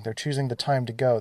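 usual speaking voice

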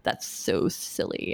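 hushed, whispered relish; gleeful whisper. Retold or imitated. Viewer savouring a title drop in the movie, whispering. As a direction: whisper it slowly to yourself.

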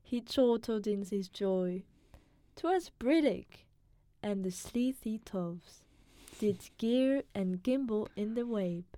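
put-on steady, careful recitation in his British accent; poem reading. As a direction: read the poem out as a cultured foreigner would. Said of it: "You wanna try reading it really quick?".

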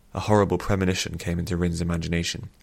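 usual speaking voice

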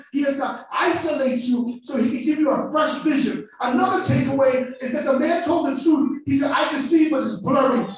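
loud, high, fervent shouted preaching; preaching at full force. Acted. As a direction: preach it to the rafters.

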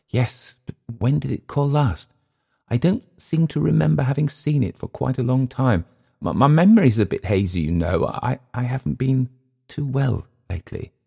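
a hint of embarrassment in the stammer; hesitant and halting; shy hesitant Englishman. A narrator's reading.

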